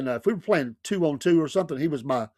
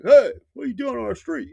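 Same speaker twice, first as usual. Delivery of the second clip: mock cat call; 8 semitones higher, 3 dB louder than usual. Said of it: "some cat calls like".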